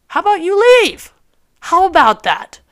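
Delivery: high, pointed mock demand to leave; sassy dismissal. Replaying telling the men to leave, sassy. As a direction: sweetly tell him to get out.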